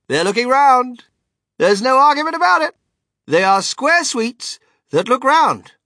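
high, very loud proclamation; triumphant cry. A narrator's reading. Wonka vindicated about his sweets, crying triumphantly. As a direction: crow each sentence like a winning argument. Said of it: "he cried triumphantly".

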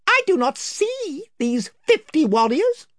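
high, proud little mouse knight; Reepicheep. Acted. Reepicheep speaks.

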